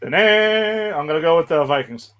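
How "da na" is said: high, sustained stadium horn honk; horn imitation. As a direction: imitate an annoying horn with your voice. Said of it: "my imitation of their stupid horn".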